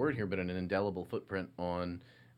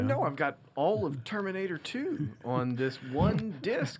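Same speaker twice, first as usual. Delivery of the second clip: mock bragging; 6 semitones higher, 3 dB louder than usual. Mock bragging about laserdisc.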